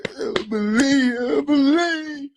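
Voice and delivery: high, loud, slow wailing; mock wail. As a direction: stretch it into a hammed-up cry. Acted wailing repeated.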